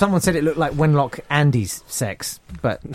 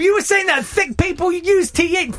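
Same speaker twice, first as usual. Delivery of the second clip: high, indignant mock London voice; exaggerated London accent. Host accuses guest of snobbery, high exaggerated London accent. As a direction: squeal it in an overdone London accent. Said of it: "doing a fairly exaggerated version of someone".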